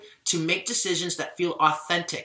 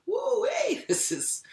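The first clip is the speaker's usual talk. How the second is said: startled whoa; slower than usual. Real, not acted. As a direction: pitch leaps up in surprise. Overwhelmed, she stops mid-sentence.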